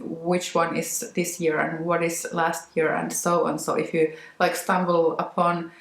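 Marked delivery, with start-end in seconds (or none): none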